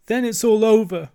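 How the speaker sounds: high, loud, theatrical despair; mock sobbing wail. A narrator's reading. Toad despairs into sofa cushions, acted sobbing wail. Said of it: "sobbed the Toad, crying into the sofa cushions".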